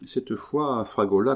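plain reading voice

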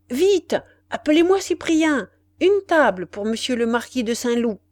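urgent calling out. Acted. Café owner summoning staff for the marquis, urgent call. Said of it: "s'écriait le patron".

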